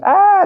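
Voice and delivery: high, loud imitated scream; mock scream. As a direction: shriek like frightened men in a boat. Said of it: "they begin to scream".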